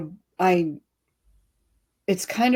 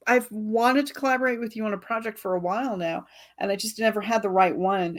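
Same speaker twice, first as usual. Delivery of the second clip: usual speaking voice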